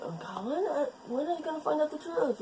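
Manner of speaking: high, fast, pleading impatience; impatient. Retold or imitated. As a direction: beg for answers in a high voice. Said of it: "you're, like, going".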